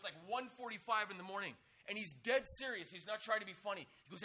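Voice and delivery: usual speaking voice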